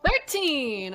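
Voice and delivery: high, loud, sharp correction. Real, not acted. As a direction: call the number out firmly. GM corrects the roll loudly.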